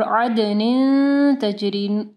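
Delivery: अदनिन with a held, humming final n; slow अदनिन flowing nasally into तजरी; ikhfa ghunnah. Shown as a demonstration. Teacher repeats for students, held ghunnah. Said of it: "इख्फा गुन्ना होगा".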